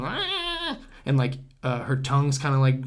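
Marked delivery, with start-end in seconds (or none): 0.0-0.8 high-pitched sick cat cry; cat moan, pitched way up; imitated cat yowl. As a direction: mimic the poisoned cat's wail. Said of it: "and going".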